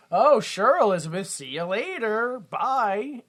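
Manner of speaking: imitated airy, agreeable send-off; breezy fake farewell. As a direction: toss off a singsong goodbye.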